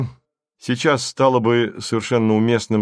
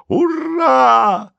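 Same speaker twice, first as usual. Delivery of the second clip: очень высокое громкое протяжное «ура»; завопи от радости на самой высокой ноте; ликующий крик; 5 dB louder than usual. A narrator's reading. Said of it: "закричал и Марик". (восторженный вопль)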